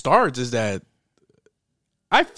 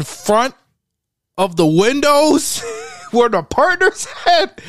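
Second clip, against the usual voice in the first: high, loud, slow incredulity; exasperated disbelief; 12 semitones higher, 5 dB louder, slower than usual. Real, not acted. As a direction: draw it out, climbing high in disbelief. Exasperated rant at the robbers' stupidity.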